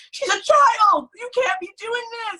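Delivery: very high, shrieked protest; imitated outraged mom. Mom going off at staff over her child, shrill outrage. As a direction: mom losing it, loud start at top pitch. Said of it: "went off".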